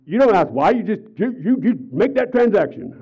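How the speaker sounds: high, loud, put-on bossy voice; a pastor imitating his demanding wealthy client, high and loud; imperious rich client. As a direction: order him about like a rich client who won't be questioned. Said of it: "She says".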